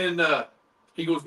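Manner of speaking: usual speaking voice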